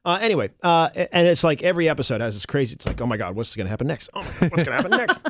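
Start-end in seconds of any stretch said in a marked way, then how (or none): 3.0-4.1 low, soft, quick muttered reaction; hurried suspenseful reaction. Acted. Host acts out a hooked viewer, hushed and hurried. 4.2-5.2 quick, laughing mock suspense; excited suspenseful reaction. Host replays a hooked viewer, giddy and hurried.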